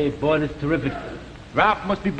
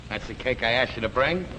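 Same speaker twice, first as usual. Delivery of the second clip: sour echo; 8 semitones lower, 4 dB quieter than usual. Acted. Echoes wife's question back sourly.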